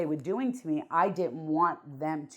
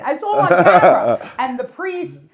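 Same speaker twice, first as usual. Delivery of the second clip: laughing; 5 semitones higher, 17 dB louder than usual. Real, not acted. Laughing about the crow.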